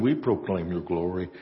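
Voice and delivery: ordinary talk, nothing marked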